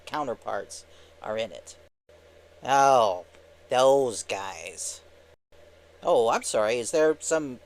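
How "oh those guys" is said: lightly voiced disdainful cartoon sidekick; a hint of scorn in a cartoon voice; Timon. Timon scorning the other pair.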